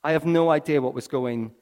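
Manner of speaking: ordinary talk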